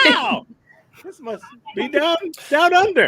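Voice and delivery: high squeaky voice; helium voice. Pretend. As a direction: squeak like you inhaled helium. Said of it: "Is the air made of helium?".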